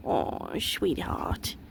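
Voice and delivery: breathy whispered coo; whispering. Real, not acted. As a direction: whisper it tenderly to the goat. Petting goat.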